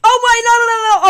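loud, high, panicked. Real, not acted. Gamer about to fall, panicking loudly. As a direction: cry no, no, no in alarm.

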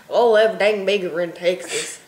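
loud mock Texas accent. Shown as a demonstration. Showing friends that Texas has an accent, mock Texas accent. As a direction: belt out a Texan accent. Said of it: "that's an accent too".

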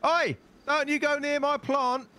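loud high yell; shouting. Acted. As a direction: bellow a warning across the field. Yelling at someone near his plant.

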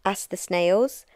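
plain reading voice

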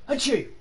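loud, high sneeze; a big sudden sneeze; sneezing. Real, not acted. Announced the sneeze, "ready?.

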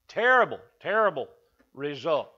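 preacher stressing Terrible, terrible result; emphatic repeat. Real, not acted. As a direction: hit Terrible hard, then ease down to result. Emphatic repetition of a word.

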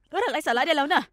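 furious mother scolding her daughter; angry scolding. Acted. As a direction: bark it a second time, harder. Mother repeats the scolding louder.

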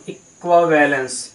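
ordinary talk

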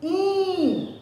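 In held high so the nose vibrates; teacher isolating the nasal In; isolated nasal syllable. Shown as a demonstration. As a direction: hum In through the nose. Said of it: "vibrou aqui o nariz".

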